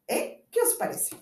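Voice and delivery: high, proud question; triumphant question. Acted. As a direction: show off the verse.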